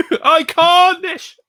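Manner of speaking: high, loud, slow drawn-out Iconish; slow loud mock-grand Iconish; mock announcer. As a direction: stretch Iconish out in a hammed-up high voice. Echoing the mocking drawn-out name.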